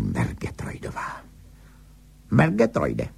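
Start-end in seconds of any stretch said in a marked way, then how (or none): 2.3-3.1 mock screech. A narrator's reading. Angry wife calls her husband by surname, restrained screech. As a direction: call out a husband's surname crossly. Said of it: "zaječela".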